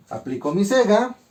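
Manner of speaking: ordinary talk, nothing marked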